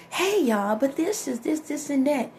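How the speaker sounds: fake upbeat vlogger. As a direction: imitate a fake cheerful influencer. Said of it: "I can tell it's fake".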